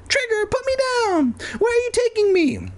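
very high crying squeak; squeaky mouse cry. Acted. Mouse Evan carried off by dog.

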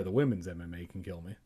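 usual speaking voice

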